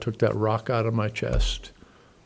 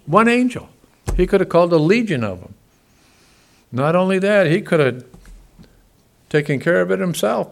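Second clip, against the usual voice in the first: louder, higher preaching that builds on each line; fiery preaching; 4 semitones higher, 5 dB louder than usual. Acted. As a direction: pastor pressing the point home to his congregation, preaching loud. Preaching at full force.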